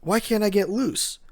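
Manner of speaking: struggling against the restraints, fed up; frustrated outburst. Retold or imitated. Trapped Uruchi rails at Hiyori, frustrated and strained.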